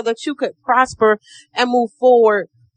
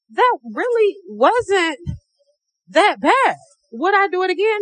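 relieved; 8 semitones higher than usual. Retold or imitated. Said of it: "I'm like".

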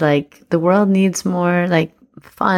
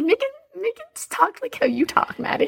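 imitating the partner in a squeaky, breathy higher voice, softer; high mock partner voice; 5 dB quieter than usual. To her business partner, teasing how she used to nag, in a squeaky parody voice. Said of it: "you'd be like".

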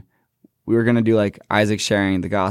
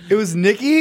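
high, incredulous it was Nikki; disbelieving question, way up; amazed; 13 semitones higher, 3 dB louder than usual. Real, not acted. Listener amazed.

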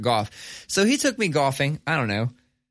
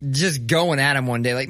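ordinary talk

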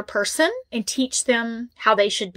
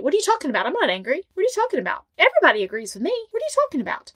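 rising, higher and higher disbelief; indignant voice that rises higher each time; mock offended denial; 5 semitones higher, much faster than usual. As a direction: play the outraged gaslighter, climbing in pitch. Toxic person acts offended.

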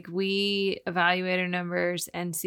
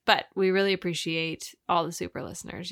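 normal talking voice

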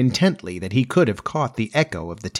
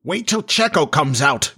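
mildly growled retort, pitched up; 5 semitones higher than usual. A narrator's reading. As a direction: grumble back defiantly. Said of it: "growled Starkey".

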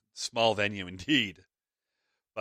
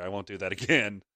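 ordinary delivery

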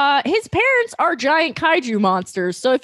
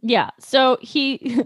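usual speaking voice

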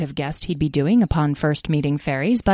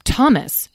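"Thomas" pitched higher, firmly; repeated more firmly; 6 semitones higher, 4 dB louder than usual. Acted. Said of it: "repeated Tom".

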